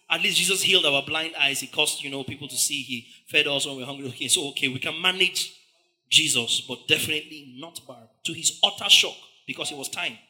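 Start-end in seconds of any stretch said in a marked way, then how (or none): none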